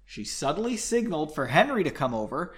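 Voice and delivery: ordinary delivery, nothing marked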